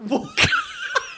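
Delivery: sudden squealing laugh; laughing high in disbelief; high burst of laughter. Real, not acted. Shocked by the story.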